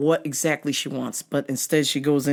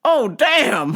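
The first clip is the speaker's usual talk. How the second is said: mock shock; 9 semitones higher, 5 dB louder than usual. His own shocked reaction.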